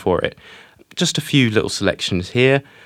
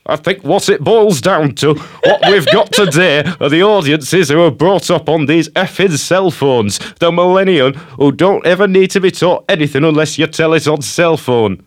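higher, louder mock Yorkshire grumble, laughing at times; Ridley impression; 6 semitones higher, 4 dB louder than usual. Host quotes Ridley blaming young people, in his voice. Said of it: "as if Sir Ridley had walked into the studio".

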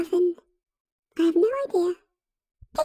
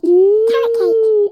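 loud, slow longing; 2 semitones higher, 8 dB louder, slower than usual. Acted. Crying out for carrot cake.

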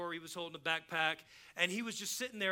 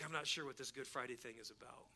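hushed, lower murmur imitating a confidence; retold whisper; 6 semitones lower, 9 dB quieter than usual. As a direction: ask it privately, well under your breath. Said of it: "he whispers in my ear".

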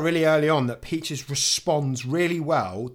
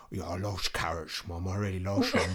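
low, soft dog voice with slurred s sounds; Peaches; 5 semitones lower, 7 dB quieter than usual. Acted. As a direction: talk as a carrot-loving dog, pitched down. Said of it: "doing Peach's voice".

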